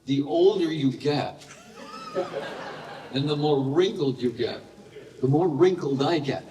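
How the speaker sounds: teasing a fan about his tattoo, laughing; laughing joke. Retold or imitated. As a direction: chuckle out the punchline to the fan.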